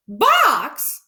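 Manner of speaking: high, loud startled question; exclaiming. Acted. Mrs Rogers hears the chicken is in a box, exclaiming high. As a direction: yelp it out in surprise. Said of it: "exclaimed Missus Rogers".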